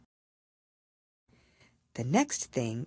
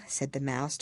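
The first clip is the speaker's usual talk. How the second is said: plain reading voice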